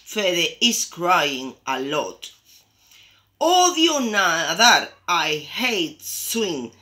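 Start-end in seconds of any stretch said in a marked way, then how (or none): 3.4-6.7 loud, drawn-out storybook whine; high, slow wail easing off; whining complaint. A narrator's reading. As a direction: complain like a poor little duckling, stretching the words. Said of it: "se queja el pobre Fede".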